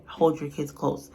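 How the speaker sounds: ordinary talk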